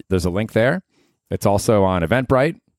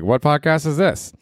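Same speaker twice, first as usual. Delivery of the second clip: curious doctor voice; 7 semitones higher than usual. Retold or imitated.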